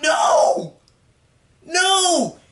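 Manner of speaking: high, drawn-out dramatic wail; horrified re-enacted cry. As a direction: cry out in hammed-up disbelief, twice. Acting out horror at boy's death.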